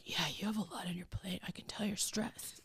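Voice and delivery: Tom imitated in a very soft, low voice; hushed imitation. Tom gently telling her she looks stressed, hushed imitation. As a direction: drop to a near-whisper to quote him. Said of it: "when Tom will be like".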